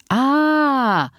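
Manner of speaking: long, high drawn-out 'ah'; one voice imitating a class chorus; drawn out like children all understanding. A narrator's reading. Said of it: "zeiden de kinderen in koor".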